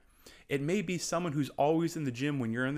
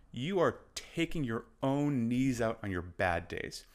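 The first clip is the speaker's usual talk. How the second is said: ordinary talk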